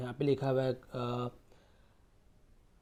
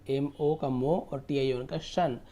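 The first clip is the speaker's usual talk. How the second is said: normal talking voice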